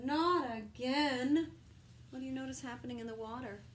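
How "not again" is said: loud, very slow despairing wail; hammed-up slow wail; despairing cry. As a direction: drag it out in despair. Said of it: "cried the unicorn".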